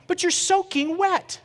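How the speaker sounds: astonished protest. Retold or imitated. Husband telling his sweating wife she's soaking, slow and high. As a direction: stretch it out in disbelief.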